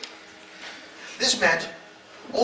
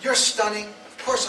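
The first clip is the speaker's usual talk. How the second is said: ordinary talk, nothing marked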